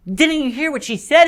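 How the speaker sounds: high-pitched, urgent accusing question; upset. Acted. Polly confronts Diggory over his choice, high and upset. Polly repeats it, escalating quarrel.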